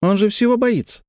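put-on very high, belittling child voice; scornful little girl. A narrator's reading. Sister tells Grant brother fears everything, high scornful voice. Лекси свысока о брате.

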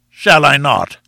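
loud, explosive retort; menacing defiance. A narrator's reading. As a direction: throw the challenge straight back, loudly. Enraged professor answers a challenge.

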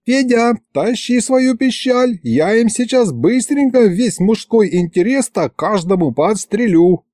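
angry wife yelling. A narrator's reading. Angry wife orders husband to fetch the gun, mock yell. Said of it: "снова заорала на них Варя".

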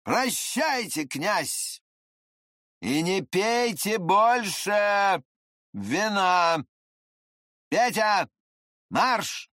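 high, drawn-out called-out orders; loud farewell. A narrator's reading. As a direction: call out parting words, spaced and stretched. Rowdy man calls parting orders.

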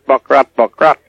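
clucking mimicry of a guinea hen; guinea hen call. Farmer showing the host the guinea hen's call, mimicked. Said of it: "the hen goes buck rat".

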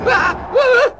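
very high, loud yelps; startled cries. Acted. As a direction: yelp in alarm. Ghost cries out.

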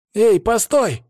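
put-on high, loud boy calling out; boyish voice. A narrator's reading. Boy hails the man from behind, high boyish shout. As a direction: call after someone in a high boy's voice. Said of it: "знакомый мальчишеский голос".

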